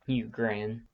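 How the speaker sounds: usual speaking voice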